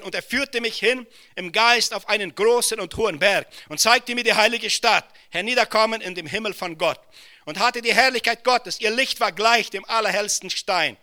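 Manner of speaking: high, loud preaching at full force; rising sermon fervour; fiery preaching. Acted. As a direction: preach it out to the whole hall. Proclaiming Revelation's heavenly city.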